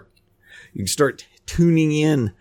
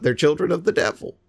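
usual speaking voice

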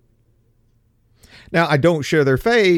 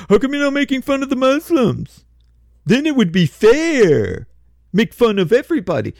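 higher, louder nagging caricature of a complainer; mock whiny complaint; 8 semitones higher, 3 dB louder than usual. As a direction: whine it like a petulant believer.

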